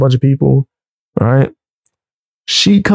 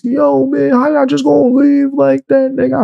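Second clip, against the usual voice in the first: higher, whining voice on the phone; mock whiny caller; 11 semitones higher than usual. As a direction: imitate the angry friend complaining. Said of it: "I don't be calling my phone yelling".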